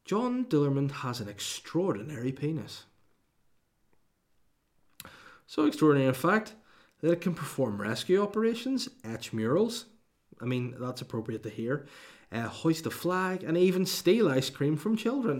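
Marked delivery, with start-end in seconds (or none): 0.0-9.9 mock posh reading, rising higher toward the end; lightly hammed-up plummy newsreader. Host reading a Guardian article aloud in mock posh voice. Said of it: "I'll read it in a posh voice". 12.4-15.4 hammed-up plummy newsreader going on; posh voice. Host still reading the article aloud in mock posh voice. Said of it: "I'll read it in a posh voice".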